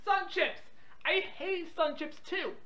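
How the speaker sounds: normal talking voice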